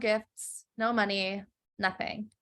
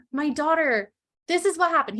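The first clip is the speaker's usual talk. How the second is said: pleading father; 6 semitones higher than usual. Retold or imitated. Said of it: "the man says".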